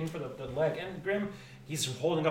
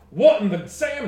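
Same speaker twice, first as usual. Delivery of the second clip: higher, louder astonished outburst; startled exclamation; 8 semitones higher, 13 dB louder than usual. Acted. Grim is caught off guard, startled shout. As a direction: gasp it out in shock.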